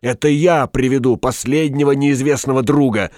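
high, heated declaration; frenzied insistence. A narrator's reading. Shopkeeper claiming the errand for himself, frenzied insistence. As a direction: insist feverishly, pitched well up. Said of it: "в выступлении шептал Стамодор".

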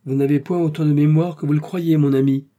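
slightly dry, firm rebuke; a little curt. A narrator's reading. Wife correcting her husband's memory, a little curtly. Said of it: "lui dit-elle un peu sèchement".

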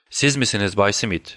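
plain reading voice

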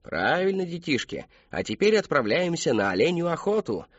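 high, sing-song showman voice; clown entertainer. A narrator's reading. Clown announces a game to the children, playful showman.

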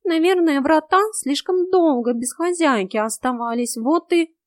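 high, loud cat voice; high-pitched, insistent explanation; agitated cat. A narrator's reading.

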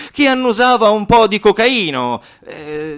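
usual speaking voice

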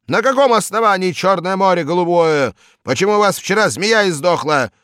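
high, loud, relentless bellowed questions; loud furious interrogation; ranting boss. Acted. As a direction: scream at him like a tyrant. Boss berates the teacher.

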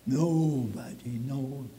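droopy cartoonish lament, hammed up; goofy voice. Shown as a demonstration. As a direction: ham up a silly sad sigh. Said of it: "I can do that with my goofy voice".

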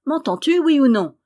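high, loud impatient demand; exasperated, petulant outburst; growing petulance. A narrator's reading. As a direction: snap at the maid for an answer. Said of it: "avec une pétulance croissante".

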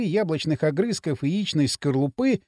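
usual speaking voice

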